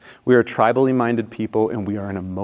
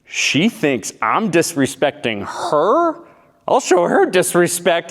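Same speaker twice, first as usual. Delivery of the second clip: louder, higher, acted affronted outrage; indignant retort; 7 semitones higher, 4 dB louder, slower than usual. Stung boyfriend takes her feelings personally, acted indignant. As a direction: build from slow disbelief to a heated threat. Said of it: "I'm standing here and I'm thinking".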